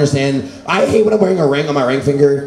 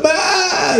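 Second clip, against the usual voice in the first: high-pitched wordless cry; mock sobbing bleat; garbled crying wail; 13 semitones higher than usual. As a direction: try to talk through crying, only a wail comes out. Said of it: "I sound like a deaf guy giving directions".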